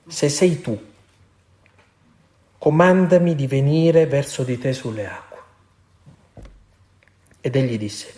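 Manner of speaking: measured, solemn scripture reading from the pulpit; Gospel reading. Acted. Priest reading Matthew to the congregation, solemn reading. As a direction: read the Gospel aloud to the congregation.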